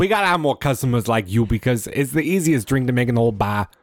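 imitated Boston bartender, cheerfully hammed up; mock Bostonian patter; Boston accent. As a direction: play the Boston barkeep praising a customer. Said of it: "And then in his boss's accent".

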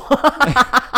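loud high laugh. Real, not acted.

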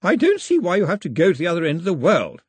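imperious grandmother pushing back. Acted. Grandmother objecting to journey.

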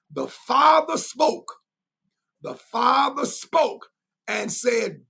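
rising, slow, loud preacher build; preaching shout. Real, not acted. Preacher to congregation, slow rising shout. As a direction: swell it up like a pulpit climax.

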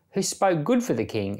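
ordinary talk, nothing marked

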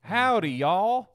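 loud, high howdy; playful cowboy greeting. Pretend. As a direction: call it out to the room, playfully.

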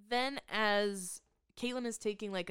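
usual speaking voice

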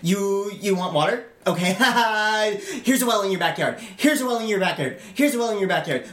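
high, rapid-fire mock generosity; hammed-up snark, tossing out favors passive-aggressively; snarky. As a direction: act a bitchy king, high-pitched and insistent. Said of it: "He kind of almost snarky".

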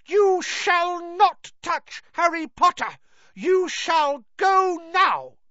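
high, loud and slow; fierce elf. Acted. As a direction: command him fiercely. Dobby drives Malfoy off.

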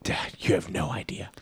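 hushed whisper of disbelief; whispered retort. Retold or imitated. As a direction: whisper it to dad, knowingly. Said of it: "I'm like".

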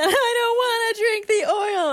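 put-on high, whining kid voice; high whiny refusal. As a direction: pitch it way up like a pouty child. Child voiced in story.

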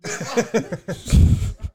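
breathy nervous giggle; nervous laugh. Pretend. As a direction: fake a jittery laugh. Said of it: "let me do my nervous laugh".

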